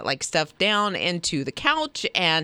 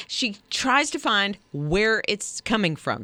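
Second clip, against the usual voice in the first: usual speaking voice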